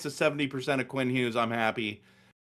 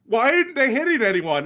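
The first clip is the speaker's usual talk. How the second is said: daughter's voice; 8 semitones higher, 4 dB louder than usual. Retold or imitated. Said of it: "But she's like".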